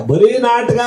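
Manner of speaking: mock-high "ಬರೀ ನಾಟಕ". Shown as a demonstration. Repeats phrase in varied tunes.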